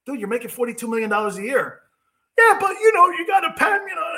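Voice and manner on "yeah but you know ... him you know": hammed-up crybaby athlete; mock whiny player. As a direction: whine back at the scolding. Mock quarterback reply.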